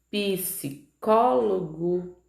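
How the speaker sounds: very slow, each syllable apart; sílaba por sílaba. Shown as a demonstration. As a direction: break Psicólogo into drawn-out pulses. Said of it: "cada impulso de voz produzido". (palavra silabada, bem devagar)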